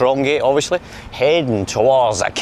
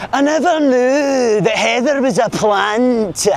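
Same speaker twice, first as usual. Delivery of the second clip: much higher, slow [name] voice; [name] impression; 11 semitones higher, slower than usual. Vlogger passes a mural and does [name]. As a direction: do the big Yin, high and drawn out. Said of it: "There's the big Ian [name]".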